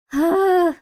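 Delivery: high, voiced sigh; exasperated sigh. Real, not acted. Streamer worn out by confusing listings, exasperated sigh. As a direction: let out a voiced groan of exasperation.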